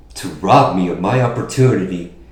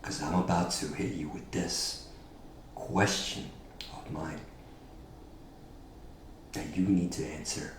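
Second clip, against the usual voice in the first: quiet menacing promo; 4 semitones lower, 13 dB quieter than usual. Acted. Hushed taunt in promo.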